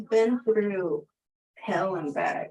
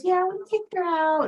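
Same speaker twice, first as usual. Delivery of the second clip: neighbor lady; 11 semitones higher, 4 dB louder than usual. Retold or imitated. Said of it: "And she goes".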